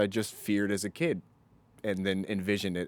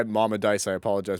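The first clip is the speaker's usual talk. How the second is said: ordinary delivery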